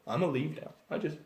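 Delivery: sneaking away. Acted. Gnome quietly sneaking out behind the half-giant. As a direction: slip off without a sound. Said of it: "And you go".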